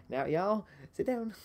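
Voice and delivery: lightly voiced sassy teacher, rising; a hint of a stern teacher.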